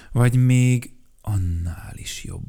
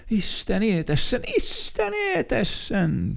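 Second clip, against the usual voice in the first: much higher, excited cheering; 13 semitones higher than usual. Acted. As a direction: cheer the birthday boy. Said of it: "kiabálták". (ujjongva kiabálják)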